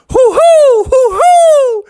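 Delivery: loud, very high owl hoots; owl hooting. Acted. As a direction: hoot like an excited owl. Owl hoots while flying.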